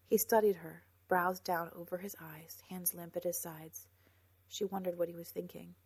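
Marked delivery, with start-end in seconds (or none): none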